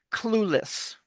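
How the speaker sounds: "clueless" with a clear L; careful pronunciation. Shown as a demonstration. Accent coach modelling a clear L in the word. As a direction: draw out the L in "clueless". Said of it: "they can't say the word".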